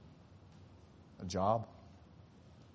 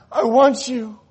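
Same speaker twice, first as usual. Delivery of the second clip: much higher and louder I want you; loud second take; 11 semitones higher, 14 dB louder, slower than usual. Acted. God declares his love loudly to the sinner. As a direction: proclaim it. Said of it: "And God said".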